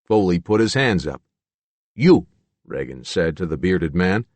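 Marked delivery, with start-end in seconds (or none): 1.9-2.3 put-on louder, higher Yugoslavian-accented command. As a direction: call him out in a Yugoslavian accent. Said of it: "I expected that from your accent".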